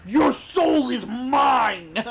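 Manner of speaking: slow, loud, drawn-out villain shout; Shang Tsung. Retold or imitated. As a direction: boom it out like a sorcerer. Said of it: "Shang Tsung from Mortal Kombat".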